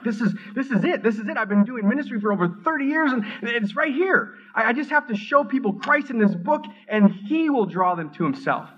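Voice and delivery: high, eager voice with a laugh; reliving the thrill of a breakthrough; excited realization. Retold or imitated. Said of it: "I was excited, and I'm like".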